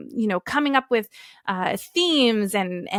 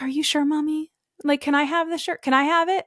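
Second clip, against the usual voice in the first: lightly voiced child, pitched up; higher, mildly pleading kid questions; imitated child; 6 semitones higher than usual. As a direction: ask like a little kid hoping for a yes. Imitates her child asking for shirt.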